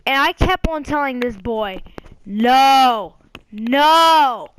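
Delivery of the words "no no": loud, emphatic retold no; firm refusal. Telling the boy he can't play, loud firm refusal. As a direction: push each no out hard. Retells refusing the pestering kid.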